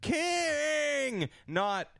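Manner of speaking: high, drawn-out cry of discovery; mock wailing yell. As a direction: call out the name in overdone anguish. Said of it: "like, you yelling your last name".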